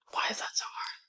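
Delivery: breathy whispered lament, barely voiced. Real, not acted. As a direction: whisper it to yourself, defeated.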